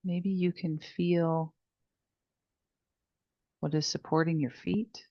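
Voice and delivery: soft, unhurried meditation voice with long pauses; guided meditation. Real, not acted. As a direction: guide them gently through each breath, hushed and spacious. Leads the body scan meditation.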